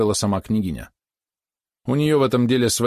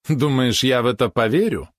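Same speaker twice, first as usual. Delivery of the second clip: scoffing; 4 semitones higher than usual. A narrator's reading. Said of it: "хмыкнул он".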